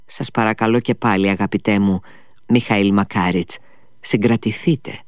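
ordinary delivery, nothing marked

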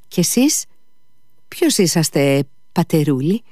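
put-on high-pitched, inquiring old woman; changed tone. A narrator's reading. Old woman asks the stranger who he is, tone changed. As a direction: sweeten the granny voice into a question. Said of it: "με ένα εντελώς άλλο τόνο η γριούλα".

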